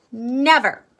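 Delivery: loud high flat-out refusal; stubborn refusal. Acted. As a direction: stamp your foot on it. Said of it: "cried Annalisa".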